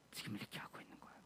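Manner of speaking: fast, hushed whisper; a quick hushed aside. Real, not acted. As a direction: drop to a whisper. Quiet aside after list.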